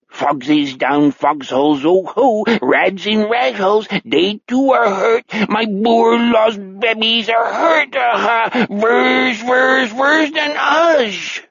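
high, slow, keening alien German; crazed, drawn-out mock-German lament; extraterrestrial accent. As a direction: wail it in an eerie pseudo-German accent, rising to a cry. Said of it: "speaking with the accent of an extraterrestrial".

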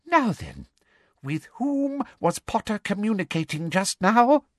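high, sugary-menacing official; Umbridge, interrogating. Acted. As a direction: question the captive in a high voice.

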